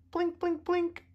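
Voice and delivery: high, light blink blink; high blinking sound. Acted. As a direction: chirp each blink up high. Tail signal sound.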